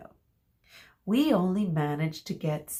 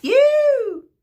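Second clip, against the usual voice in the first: shrill loud yelp; cheer; 12 dB louder than usual. A narrator's reading. Group B cheering anyway with a high yelp. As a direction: shout it with glee.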